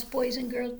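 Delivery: ordinary delivery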